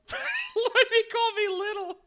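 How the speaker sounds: high, loud squeal of laughter; laughing hard. Real, not acted. Woman reacts to being called little, laughing hard. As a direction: barely get it out through laughter. Cracking up at the remark.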